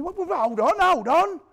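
high loud urgent shout; straining shout. Retold or imitated. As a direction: yell it while holding him down. Holding the victim during the attack.

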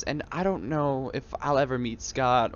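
usual speaking voice

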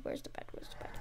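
very soft, trailing repeated question; barely voiced searching mumble; muttering to self. Real, not acted. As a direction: murmur it while searching.

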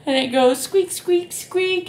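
high squeaky squeak squeak; mouse squeak. Shown as a demonstration. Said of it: "this little animal goes".

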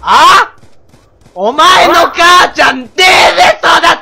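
drawn-out mocking yell that climbs high at the end; mock thug yell. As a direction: taunt him, stretching the last word into a shout. Mock thug trash talk in game. (煽るように語尾を伸ばして叫ぶ)

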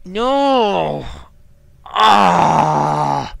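loud, drawn-out scared cry; startled scream. Real, not acted. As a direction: yell out in fright. Jump scare in horror game.

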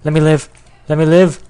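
high, loud plea; exasperated yell. Real, not acted. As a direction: cry out to be spared. Yelling at attackers in game.

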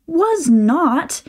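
high, offended denial; childish outraged retort; indignant protest. Acted. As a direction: shout it back at him. Kids squabbling, Momiji protests.

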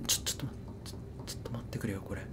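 hushed, frightened whisper. Real, not acted. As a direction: breathe it out in fear. Something lurking in the dark. (怯えたささやき声)

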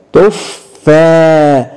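تفا very slowly, the fa doubled; drawn-out shadda. Shown as a demonstration. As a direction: hold the fa in تفا. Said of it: "لاحظ الفاء". (تفا ببطء شديد مع تشديد الفاء)

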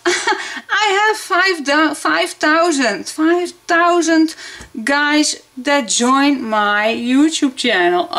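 high giddy announcement; excited laughing. Real, not acted. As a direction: burst out with giddy joy. Announcing five thousand.

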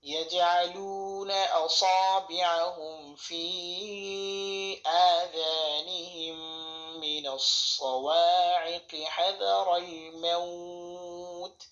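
slow, melodic tajweed chant; Quran recitation. Acted. Teacher reciting the verse in full tajweed style. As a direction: recite slowly with long held vowels.

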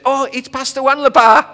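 high, loud delighted exclamation; light up at the pastor's name; excited greeting. Retold or imitated. Secretary answering the phone to the pastor, delighted.

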